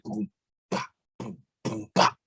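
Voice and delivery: imitated drum beat. Shown as a demonstration. Rapper explains boom bap to the host, imitating drums. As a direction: sound out kick and snare with your voice. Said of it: "It's like the drums".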